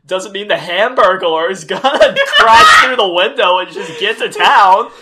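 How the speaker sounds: loud, laughing rant that slows at the end; laughing loudly. Real, not acted. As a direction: push through the laugh with rising volume. Laughing, louder hamburglar bit.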